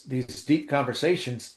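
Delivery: usual speaking voice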